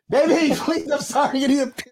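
high, laughing plea; laughing apology. Real, not acted. Husband laughing as he apologizes to his wife. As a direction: beg forgiveness through laughter.

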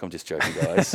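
nervous laugh through the words. Retold or imitated. To the silent pros, laughing it off. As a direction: laugh to cover the awkward silence. Joke falls flat, laughing it off.

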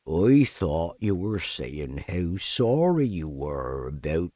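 low, slow, mournful donkey drone; deep, dragging, defeated donkey voice; gloomy Eeyore. Acted. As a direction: sigh each word out like a sad old donkey. Eeyore speaks.

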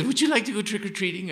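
a light imitation of his mother offering a treat; mother's voice. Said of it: "she says".

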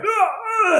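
very high, strained fake cries of pain; faked dying cries. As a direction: ham up dying noises for the bullies. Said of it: "I pretended I was, like, dying".

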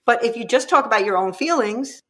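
ordinary talk

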